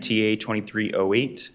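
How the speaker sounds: usual speaking voice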